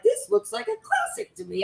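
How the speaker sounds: mock self-satisfied fighter. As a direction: play the fighter as a cocky cartoon. Mimicking the fighter's inner thought.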